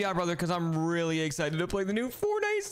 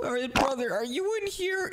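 calling out; 10 semitones higher than usual. Acted. Calling into the bathroom.

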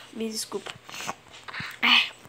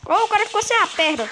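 surprised; 9 semitones higher, 5 dB louder than usual. Real, not acted. Gory game moment.

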